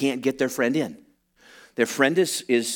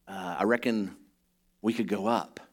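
put-on southern accent; 4 semitones lower, 6 dB quieter than usual. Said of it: "I put a southern thing on there".

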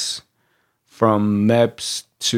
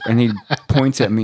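ordinary talk

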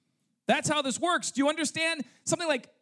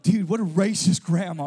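lower, louder gruff scoff; mock outraged onlooker; 7 semitones lower, 5 dB louder than usual. Preacher mocking people who judge the grandma, low loud scoff. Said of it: "for a lot of people, like".

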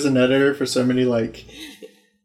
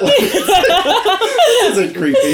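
mock-creepy line broken by laughter, higher and very slow; laughing; 4 dB louder, much slower than usual. Real, not acted. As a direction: laugh through it while sneering at the scene. Mocking the creepy scene.

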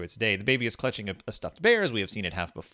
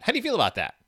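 high squeaky question; 11 semitones higher, much faster than usual. Shown as a demonstration. Asks in varied voices.

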